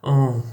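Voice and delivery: Oh pushed through the nose like French on; nasal round Oh. Shown as a demonstration. Teaching Polish nasal vowel, say it nasal. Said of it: "like on said through the nose".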